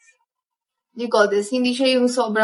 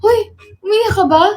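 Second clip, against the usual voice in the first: higher, louder teasing call; 7 semitones higher, 3 dB louder than usual. Retold or imitated. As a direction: poke fun with a raised voice. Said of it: "teasing friend".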